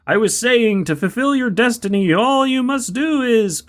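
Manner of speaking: overacted death scene. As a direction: struggle through the prophecy. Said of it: "spice stuck in my throat".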